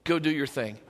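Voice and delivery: low, soft, casual release; gracious. Acted. Master letting the forgiven servant go, gracious and low-key. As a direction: let him off gently and easily. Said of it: "the master graciously looked at him".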